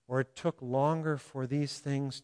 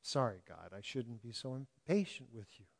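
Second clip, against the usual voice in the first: sheepish apology; 3 semitones lower, 9 dB quieter than usual. Real, not acted. Sheepish aside to God.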